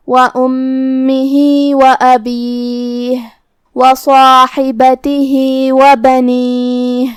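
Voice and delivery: slow, drawn-out tajweed chant; measured melodic Quranic recitation. Shown as a demonstration. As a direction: recite it slowly with full elongation.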